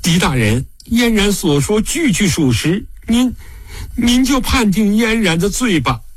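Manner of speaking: high, sorrowful plea; mournful pleading. A narrator's reading. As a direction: plead sorrowfully with halting breaks. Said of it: "哀哀地道".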